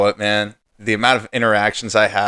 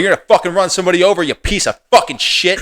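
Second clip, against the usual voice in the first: retold angry yell; 7 semitones higher than usual. Shouting at the Jeep driver who nearly hit him, furious. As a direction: bark it at the driver like you're back in the street.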